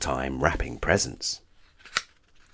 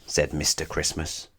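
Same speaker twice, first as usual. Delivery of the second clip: plain reading voice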